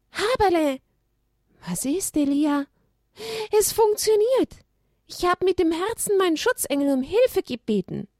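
excited donkey. Acted. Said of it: "donkey thrilled it worked".

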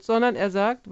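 normal talking voice

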